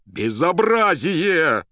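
loud, high, stretched-out howl; outraged yell. A narrator's reading. Poet yells before flinging the receiver, outraged. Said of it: "вдруг завопил Иван".

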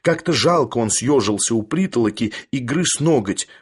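plain reading voice

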